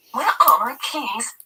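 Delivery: slow, high fretting; drawn-out anxious whine; fretful. A narrator's reading. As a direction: fret over the lost keys. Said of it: "Trish sounds fretful".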